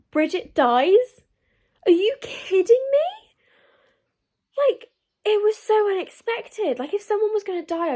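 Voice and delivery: high, loud shocked cry; shocked disbelief. Real, not acted. Reader telling viewers of a death, shocked and loud. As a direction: gasp it out in disbelief.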